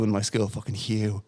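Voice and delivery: ordinary delivery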